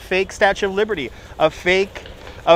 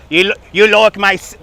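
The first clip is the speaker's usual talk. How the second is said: put-on louder, playful northern English accent; cheeky mock northern English accent; 3 semitones higher, 5 dB louder than usual. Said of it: "you like my accent there".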